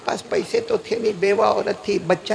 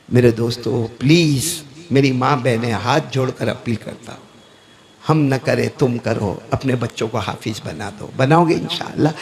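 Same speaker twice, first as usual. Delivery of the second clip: earnest plea; 10 semitones lower than usual. Real, not acted. Preacher pleads with folded hands.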